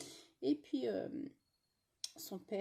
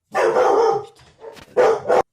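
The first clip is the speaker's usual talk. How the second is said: much higher and louder curse; exasperated shout; 13 semitones higher, 23 dB louder than usual. Real, not acted. Yelling at the barking dogs to shut up, furious shout. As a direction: let the swear fly at full volume. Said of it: "Je crie un peu".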